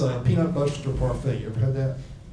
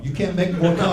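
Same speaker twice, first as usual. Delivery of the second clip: ordinary delivery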